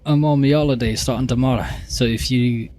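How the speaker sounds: put-on first rough try at a Scottish accent; tentative Scottish accent. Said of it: "like, Scottish or something".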